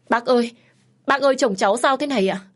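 high, loud, urgent cry for help; panicked calling out. A narrator's reading. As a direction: call the doctor over in alarm, words rushing out. Wife urgently calls the doctor.

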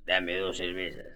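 put-on low slurred drunk voice; drunk man. Drunk in the confessional orders beer, low and slurred. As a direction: mumble it like a drunk.

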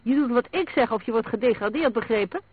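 high, loud angry order; heated, bullying command; roared threat. A narrator's reading. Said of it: "brulde Ross".

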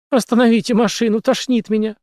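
high-pitched, urgent demand; insistent demand. A narrator's reading. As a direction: demand it while feeling sick. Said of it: "потребовала Ритка Кукис".

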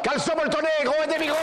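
high, sing-song street vendor call; hawking fleecy underpants, wholesale and half-wholesale, in a high sing-song cry; market hawker cry. Pretend. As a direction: cry it out like a stallholder hawking his wares.

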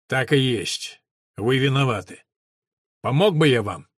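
ordinary delivery, nothing marked

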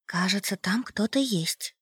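high soft frightened whisper; a scared girl breathing the words; hushed young woman. Acted. Masha whispers at the door. (испуганный шёпот девушки)

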